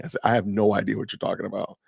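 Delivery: ordinary talk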